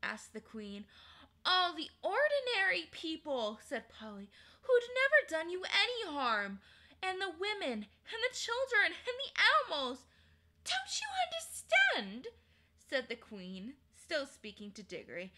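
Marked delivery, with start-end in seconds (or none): 1.4-10.1 put-on higher indignant young girl. Polly to the queen, higher indignant girl. 10.6-12.3 high, loud scorn; scornful queen. Acted. Queen to Polly, high scornful retort.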